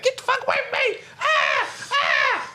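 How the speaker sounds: hammed-up terrified woman screaming; mock scream. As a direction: shriek like she's being chased. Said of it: "She's like".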